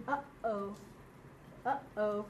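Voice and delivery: uh-oh broken by a catch in the throat. Shown as a demonstration. Teacher showing the aleph sound, uh-oh with a throat catch. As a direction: stop the breath between "uh" and "oh" in uh-oh. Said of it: "coming from the throat".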